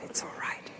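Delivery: breathed, tender whisper. Retold or imitated. Grandpa holding his sorry grandson, whispering comfort. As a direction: hush it into the child's ear.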